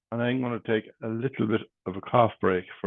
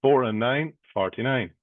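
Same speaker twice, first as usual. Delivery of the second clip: bingo caller. Acted. Calls bingo numbers.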